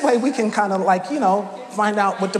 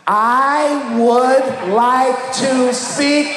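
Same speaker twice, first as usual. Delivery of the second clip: very slow, loud, higher, overenunciated; slow exaggerated request; 5 semitones higher, 4 dB louder, much slower than usual. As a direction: spell it out for the machine. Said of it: "You're like".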